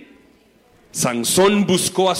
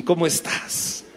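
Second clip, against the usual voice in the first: whisper; 5 dB quieter than usual. Acted. Dalila whispers tenderly.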